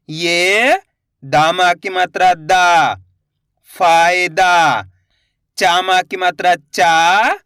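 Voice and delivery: slow drill, vowels drawn right out; letter-by-letter repeat-after-me. Shown as a demonstration. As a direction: sound out each letter, pause, then blend slowly. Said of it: "मेरे साथ साथ इनको repeat करना है". (एक-एक अक्षर खींचकर, धीरे)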